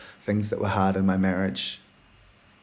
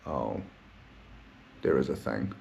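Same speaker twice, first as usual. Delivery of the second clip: dawning realization; 9 semitones lower, 9 dB quieter than usual. Retold or imitated. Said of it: "I'm like".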